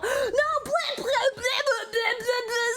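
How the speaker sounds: high, spluttering yelp turning into a wail; startled protest. Real, not acted. Streamer startled by a spider in the game, high panicked protest. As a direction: splutter in high-pitched fright, then plead.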